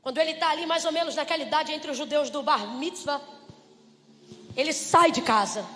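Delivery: ordinary delivery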